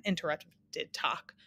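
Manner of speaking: plain reading voice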